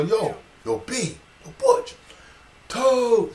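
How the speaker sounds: high, loud calling out. Retold or imitated. Spotting Butch near the train station, calling out. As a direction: shout across the street to an old friend.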